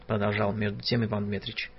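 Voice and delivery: ordinary delivery, nothing marked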